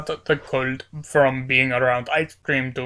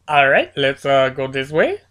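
ordinary talk, nothing marked

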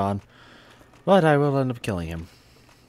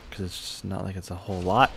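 normal talking voice

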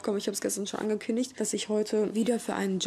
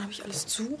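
hushed whisper to herself; 6 dB quieter than usual. Real, not acted. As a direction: whisper the question under her breath. Checking door, talking to herself.

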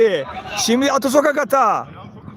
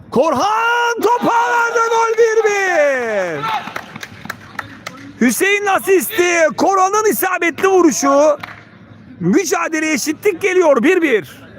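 screaming football commentator; 6 semitones higher than usual. Real, not acted. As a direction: yell the goal call. Equalising goal is scored.

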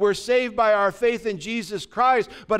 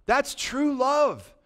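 slow, emphatic and higher; drawn-out "That's true love"; stress on love; 7 semitones higher, much slower than usual. Real, not acted. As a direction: linger on "love" in awe. Preacher's emphatic slow line.

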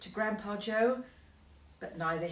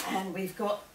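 plain reading voice

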